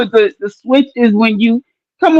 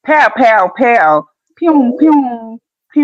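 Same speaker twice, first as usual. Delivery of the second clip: gunshot noises; much slower than usual. Shown as a demonstration. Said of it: "imitating gun".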